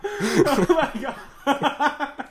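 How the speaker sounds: slow, laughing oh my god; drawn-out laughing disbelief. Real, not acted. Shocked laughing reaction.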